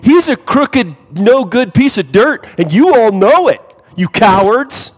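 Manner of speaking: loud, high accusing rant; loud furious tirade against a crooked man; angry denunciation. Retold or imitated. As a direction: tear into them in front of everyone. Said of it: "he would have been in the right to go".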